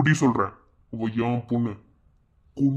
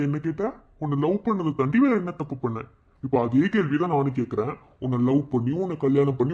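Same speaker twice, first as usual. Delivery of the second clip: ordinary talk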